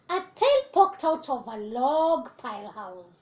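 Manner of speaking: plain reading voice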